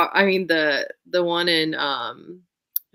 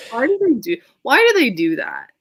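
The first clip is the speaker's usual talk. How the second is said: higher and louder, fed-up why; exasperated; 7 semitones higher, 7 dB louder than usual. Real, not acted. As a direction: throw up your hands at the book. Exasperated complaint about the book.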